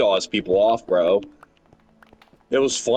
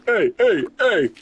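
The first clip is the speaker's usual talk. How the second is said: imitated street guy; 12 semitones higher, slower than usual. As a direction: hail him like the crackhead did. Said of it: "He's like".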